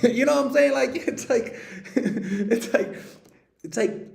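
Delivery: high laughing talk. Real, not acted. Vlogger laughing at his own impression, laughing. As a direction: laugh through the words.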